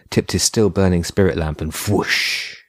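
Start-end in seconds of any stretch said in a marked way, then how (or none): none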